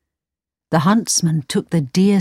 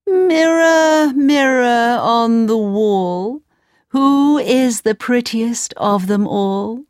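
queen voice; 4 semitones higher, slower than usual. Acted. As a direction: call out grandly to the mirror. Queen asks mirror again.

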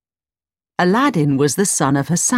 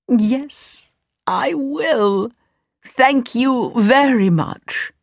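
put-on high, deliberate old man's thanks; grateful old man; 7 semitones higher, much slower than usual. Old man accepts dinner from the mother, grateful old man voice. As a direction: accept with pointed, high-pitched courtesy.

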